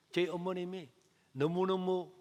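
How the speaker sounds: normal talking voice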